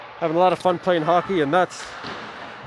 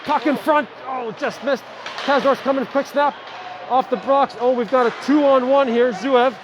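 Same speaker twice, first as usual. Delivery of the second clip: excited call; 6 semitones higher, slower than usual. Real, not acted. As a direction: build the call as the two-on-one breaks. Scoring chance, two-on-one rush.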